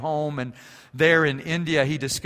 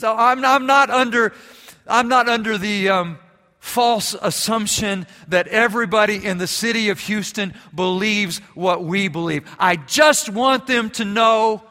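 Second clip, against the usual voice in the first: loud, much higher climactic preaching; full-force preaching; 9 semitones higher, 10 dB louder than usual. Acted. Pastor pressing his church to share their belief, loud preaching. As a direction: drive the point home to the congregation.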